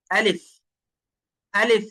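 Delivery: আলিফ said short, with no long held vowel; brief, careful আলিফ; letter-name demo. Shown as a demonstration. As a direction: model আলিফ crisply, ending on a clear f. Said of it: "এইভাবে".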